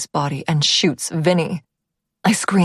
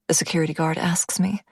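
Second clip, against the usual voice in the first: usual speaking voice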